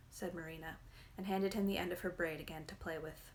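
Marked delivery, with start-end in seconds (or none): none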